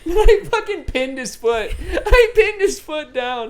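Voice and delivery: high, squealing through laughter; laughing disbelief. Real, not acted. Realizing he pinned his friend's foot, laughing disbelief.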